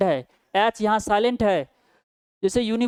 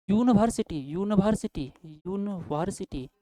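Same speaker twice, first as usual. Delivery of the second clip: University repeated, fading softer and slower each time; pronouncing slowly; 4 dB quieter than usual. Shown as a demonstration. Teacher sounding out the word for students, fading. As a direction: sound out university, trailing off quietly. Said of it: "उच्चारण करके देखिए".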